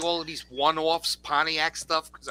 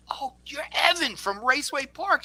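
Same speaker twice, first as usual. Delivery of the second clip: higher, lit-up recognition; retold recognition; 10 semitones higher than usual. Recognizing an old friend at the counter, delighted. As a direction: it suddenly clicks who he is.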